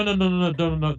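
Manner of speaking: normal talking voice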